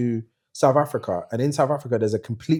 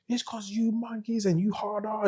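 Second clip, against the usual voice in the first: much higher, quieter mock voice; ranting Italian driver; 11 semitones higher, 8 dB quieter than usual. Italian driver hurling abuse at the man moving his car, imitated high. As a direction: imitate an abusive rant in a high, thin-volume voice. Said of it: "he was like".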